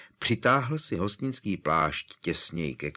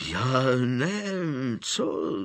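frightened stammer; 5 semitones higher, much slower than usual. Acted. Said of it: "zalikal se Mundungus".

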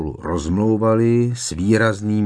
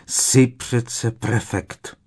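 slow, higher-pitched mild growl; growled reproach; 4 semitones higher, much slower than usual. A narrator's reading. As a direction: scold with a drawn-out, restrained growl. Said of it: "zavrčela Hermiona". (vyčítavě a táhle, mírně zavrčet)

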